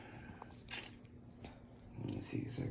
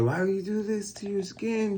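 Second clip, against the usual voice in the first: higher-pitched mock scolding mother; imitating upset mom; 12 semitones higher than usual. Son retelling mom scolding his new tattoo, high mock scold. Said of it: "She was, like, freaking out".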